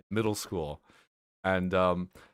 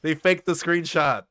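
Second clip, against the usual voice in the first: louder, higher outburst of disbelief; incredulous; 7 semitones higher, 5 dB louder than usual. Real, not acted. To the guest who admits the screenshot was faked, loud incredulous exclaim. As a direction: blurt it out as the penny drops.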